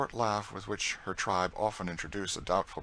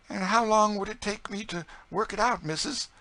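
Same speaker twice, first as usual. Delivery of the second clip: very high, excited dialect voice; delighted Southern cook; 14 semitones higher, 5 dB louder than usual. A narrator's reading. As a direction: gush with joy in folksy Southern dialect. Narrator voices Chloe delighted.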